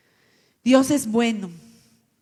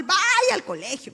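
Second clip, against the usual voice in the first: slow higher firm command; mother's order; 9 semitones higher, 6 dB louder, slower than usual. Retold or imitated. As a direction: send the child off firmly. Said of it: "me decía". (orden firme de la madre)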